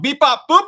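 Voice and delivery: loud, high mock alert; imitated electronic beeps. Streamer announcing a viewer's message, loud high beeping noises. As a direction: punch out cartoon robot beeps.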